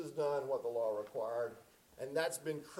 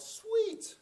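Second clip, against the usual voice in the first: high, loud cheer at a great shot; excited yell; 5 dB louder than usual. Retold or imitated. Watching a basketball game at grandmother's, excited high yell. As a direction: yell it out like a fan. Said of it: "and I yelled".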